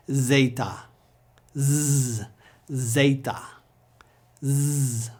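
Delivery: "Zeta" as "ZAY-ta", then a long buzzed zzz; English Z sound. Shown as a demonstration. Teacher leads repetition, buzzing Z. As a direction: alternate "Zeta" with "Z" held as a buzz. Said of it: "just like our English Z".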